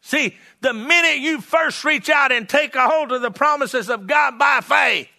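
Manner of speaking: driving sermon crescendo; fervent preaching. Real, not acted. Evangelist urging listeners to grab God's promises, fervent preaching. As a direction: preach it out in one rising push.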